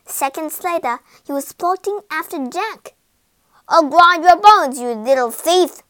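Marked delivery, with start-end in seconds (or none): 3.7-5.8 loud, booming giant's threat; threatening giant. A narrator's reading. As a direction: bellow a threat at the thief. Giant threatens fleeing thief.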